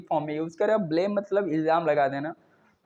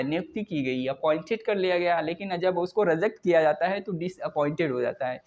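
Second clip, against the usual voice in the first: ordinary talk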